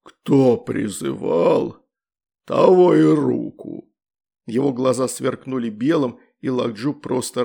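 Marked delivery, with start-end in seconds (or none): 0.0-3.9 very slow, louder menacing demon. A narrator's reading. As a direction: intone the price coldly. Demon states its price.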